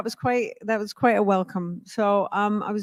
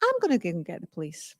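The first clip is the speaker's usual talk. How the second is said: low, quick, softer comeback; cool retort; 3 semitones lower, 8 dB quieter, faster than usual. Retold or imitated. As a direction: undercut the threat, unbothered. Her calm retort to his threat.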